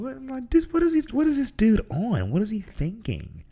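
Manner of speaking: high, baffled disbelief; can't believe what he just heard; friend's odd comfort met with high baffled disbelief. Retold or imitated.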